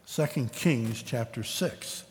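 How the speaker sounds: "Second Kings chapter six" said again slowly, each word set apart; slow repeat. Shown as a demonstration. Preacher repeating the reference slowly for the congregation.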